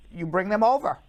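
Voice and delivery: ordinary talk, nothing marked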